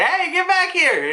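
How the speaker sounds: high-pitched call after someone fleeing; mock calling out. Luffy chases the fleeing Kizaru, mock calling out. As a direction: yell after them in a cartoon voice.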